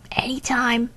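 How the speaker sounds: soft unvoiced whisper. Kid whispering back to Mister Trilby. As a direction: breathe the word out quietly.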